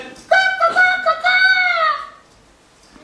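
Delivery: very high, loud, drawn-out crow cawing; crow's caw. Acted. Crow calling at sunrise, high and long. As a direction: caw like a crow greeting the dawn.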